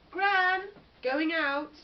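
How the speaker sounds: loud, high call into the house. Acted. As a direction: shout it back into the house. Sadie calling into the house.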